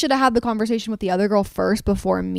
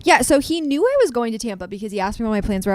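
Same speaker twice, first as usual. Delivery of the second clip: ordinary delivery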